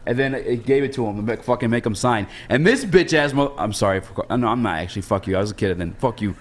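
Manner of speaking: ordinary talk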